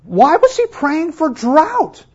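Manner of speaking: high, slow, disbelieving question; incredulous question. Shown as a demonstration. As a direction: ask it as if it makes no sense. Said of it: "rhetorical question".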